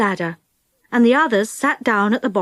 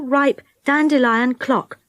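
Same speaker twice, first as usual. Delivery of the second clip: plain reading voice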